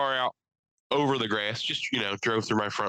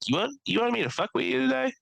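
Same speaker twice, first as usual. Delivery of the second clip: higher mock voice for the truck; a touch of the truck talking; 13 semitones higher than usual. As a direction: tease like a spiteful truck. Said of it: "it just started being like".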